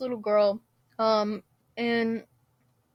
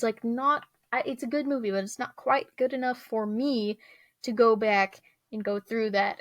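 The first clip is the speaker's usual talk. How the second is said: usual speaking voice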